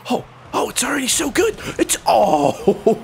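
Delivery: ordinary talk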